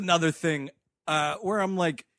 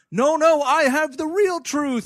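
insistent; 8 semitones higher, 6 dB louder, slower than usual. Retold or imitated. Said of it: "anyone who's like".

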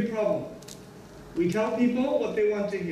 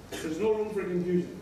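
normal talking voice

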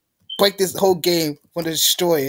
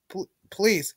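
usual speaking voice

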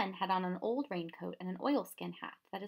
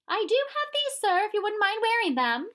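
much higher, louder woman's voice; shy housekeeper; 14 semitones higher, 10 dB louder than usual. A narrator's reading. Missus Primrose offers the professor her garters, in a high shy voice. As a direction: offer them shyly as the housekeeper. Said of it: "shyly produced".

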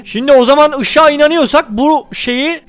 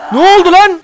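higher and louder, what happened; alarmed shout; 4 semitones higher, 3 dB louder than usual. Real, not acted. Gamer jolted by a sudden enemy, loud alarmed shout.

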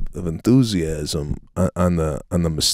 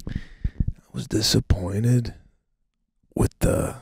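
disappointed whisper; 3 semitones higher, 4 dB quieter than usual. Real, not acted. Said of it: "sighs, disappointed".

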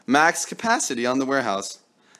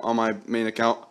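usual speaking voice

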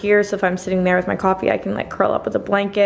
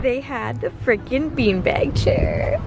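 excited whisper; 2 semitones higher, much slower than usual. Real, not acted. Whispering news in the store.

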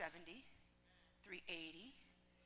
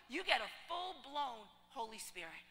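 normal talking voice

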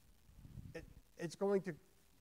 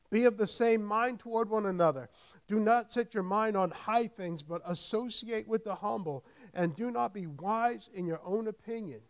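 loud emphatic reading, slowing at the end; preacher reading Romans to the congregation; scripture proclamation; 6 semitones higher, 9 dB louder than usual. Acted. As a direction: lay out each line of the verse firmly.

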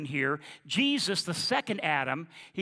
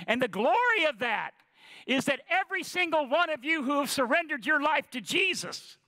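normal talking voice

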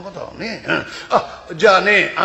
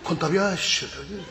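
softer, curt mumble of condolence; small curt voice; 4 semitones lower, 8 dB quieter than usual. Shown as a demonstration. Mother's instruction to a mourner, small curt mumble. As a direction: say it small and blunt. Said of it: "小さい声でブスッと言えって言って".

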